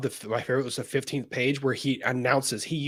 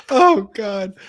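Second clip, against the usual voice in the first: laughing; 11 semitones higher, 6 dB louder than usual. Real, not acted. Laughing hard at a chat joke.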